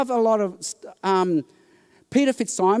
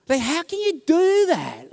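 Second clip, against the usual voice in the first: indignant; 8 semitones higher than usual. Real, not acted. Indignant rhetorical question.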